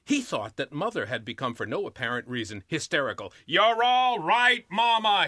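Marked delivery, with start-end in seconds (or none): 3.5-5.3 loud, slow shout to soothe her; calming shout. A narrator's reading. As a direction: bellow it slowly to calm mother. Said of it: "he shouted, trying to calm her".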